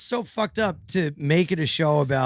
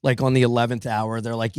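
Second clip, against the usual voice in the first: ordinary talk, nothing marked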